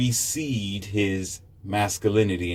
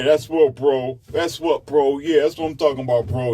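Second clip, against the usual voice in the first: lightly voiced bro-ish boss woman, louder; boss babe; 3 semitones higher, 3 dB louder than usual. Businesswoman bragging to a buddy, mild brash swagger. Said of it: "now she talks like this".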